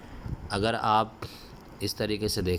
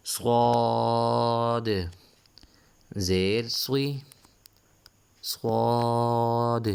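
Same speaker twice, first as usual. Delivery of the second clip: Qaida recitation drill; 4 semitones higher, much slower than usual. Shown as a demonstration. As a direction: stretch every letter name out for the class. Letter drill chant.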